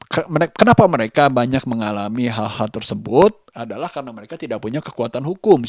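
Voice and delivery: usual speaking voice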